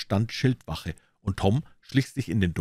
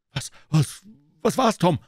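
put-on high, louder anxious boy; frightened boy; 12 semitones higher, 5 dB louder than usual. Huck pressing Tom for the news, frightened high voice. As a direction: blurt it out in fear.

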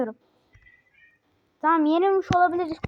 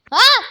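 high, very loud sudden cry; sharp startled shout; 8 semitones higher, 11 dB louder than usual. Real, not acted. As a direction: jolt out a yelp. Sudden explosion in game.

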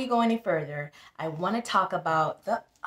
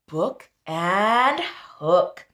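slow "Book and hook", louder; rhyme stressed; 5 dB louder, much slower than usual. Shown as a demonstration. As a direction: draw out both rhymes. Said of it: "They both rhyme".

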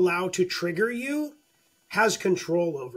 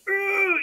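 frustrated groan; 12 semitones higher than usual. Retold or imitated. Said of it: "You're like".

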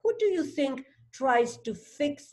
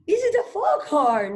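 high, louder storybook exclamation; alarmed cry; 9 semitones higher, 5 dB louder than usual. A narrator's reading. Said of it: "cried Fowl".

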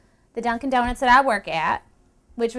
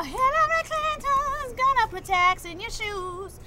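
high, slow put-on voice; drawn out in a high silly voice; mock high voice; 11 semitones higher than usual. High mocking voice.

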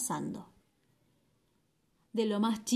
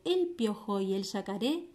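usual speaking voice

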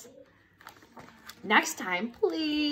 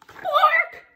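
high, loud squawk of a word; silly nonsense word; 14 semitones higher, 6 dB louder than usual. Acted. Reader forced to say what the book says, high silly blurt. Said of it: "even if the words say".

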